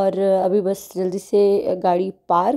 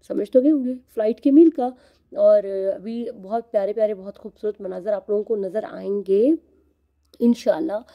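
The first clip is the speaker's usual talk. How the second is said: ordinary delivery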